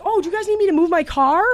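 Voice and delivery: meek high voice. Retold or imitated. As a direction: sound small and overly polite to a stranger. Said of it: "And I'm like".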